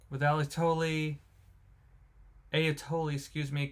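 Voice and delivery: ordinary talk, nothing marked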